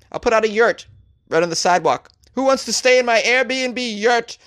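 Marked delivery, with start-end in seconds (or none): 2.3-4.4 high hawker calling out; mock street vendor calling out. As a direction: call out to passersby. Hawking his sidewalk yurt.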